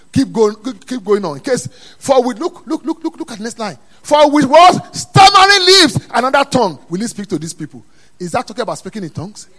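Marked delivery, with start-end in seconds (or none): 0.1-7.8 urgent build into a shouted verse; high, loud pulpit proclamation; full-force preaching. Real, not acted. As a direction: cry the scripture out to the congregation.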